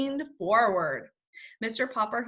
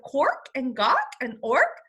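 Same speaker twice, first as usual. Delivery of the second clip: higher bird squawks; penguin cries; 3 semitones higher, 4 dB louder, slower than usual. Acted. Penguin crying out as he is carried off, squawking. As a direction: squawk each cry.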